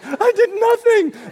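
put-on high, slow innocent child; innocent kid. Good kids to their shouting dad: innocent. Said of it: "looking at me like".

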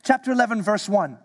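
normal talking voice

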